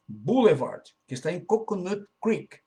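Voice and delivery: usual speaking voice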